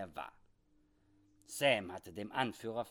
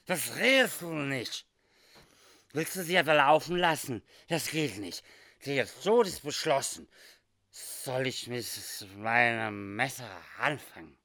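put-on loud drunk slurring; boozy, slurring and pushy; slurred; 4 semitones higher, 8 dB louder than usual. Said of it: "mit lallender Zunge".

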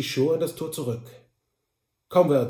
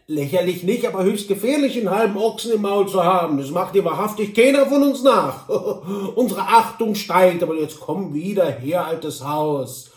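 raised, teasing dialect voice with a chuckle; amused cousin Droll; 4 semitones higher, 7 dB louder than usual. Acted. Droll jokes with his offended cousin, amused and coaxing. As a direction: tease him and coax him back.

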